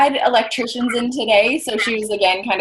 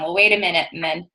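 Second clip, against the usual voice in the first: normal talking voice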